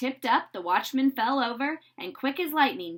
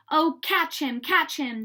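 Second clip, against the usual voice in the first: firemen crying out; 5 semitones higher, 4 dB louder than usual. A narrator's reading. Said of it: "they cried".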